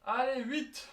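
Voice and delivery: high, loud coach call; shouted count. Real, not acted. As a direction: bark the rep count out. Coach calling push-up count.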